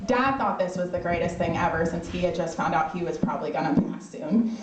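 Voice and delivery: normal talking voice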